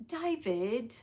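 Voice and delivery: playful British accent imitation; soft, drawn-out British accent; Sophie Choquette. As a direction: mimic a British vlogger's accent, slowly. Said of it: "I watched Sophie Choquette today and".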